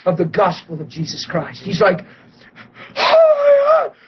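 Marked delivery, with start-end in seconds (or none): 3.0-4.0 very high, slow, stunned gasp of a phrase; shocked. Retold or imitated. Healed man stunned after squatting, high and shocked. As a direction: stretch it out in disbelief. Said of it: "like, shocked".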